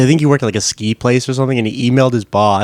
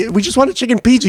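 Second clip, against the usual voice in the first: mock pleading; 11 semitones higher, much faster than usual. Pranksters pleading with angry friend.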